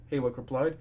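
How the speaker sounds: ordinary delivery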